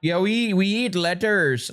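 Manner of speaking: high, slow South Bombay parody; exaggerated South Bombay drawl; mock South Bombay accent. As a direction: stretch it out mocking South Bombay English. Mimics the comedian's vegan line.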